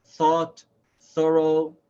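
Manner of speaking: thought and thorough said loud with a clear th; stressed th. Shown as a demonstration. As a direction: punch the th in each word. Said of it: "T H in the words think".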